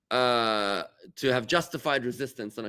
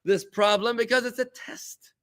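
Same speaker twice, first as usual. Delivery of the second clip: fervent declaration; 11 semitones higher, slower than usual. Retold or imitated. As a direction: declare it like a man who finally sees God's plan. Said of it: "where he says".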